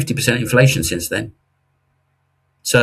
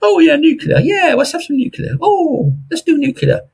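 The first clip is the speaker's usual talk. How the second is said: much higher, hammed-up ecstatic cheering; gushing fans. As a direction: parody the sudden nuclear enthusiasts. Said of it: "And now".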